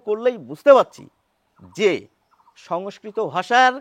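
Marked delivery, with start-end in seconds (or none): none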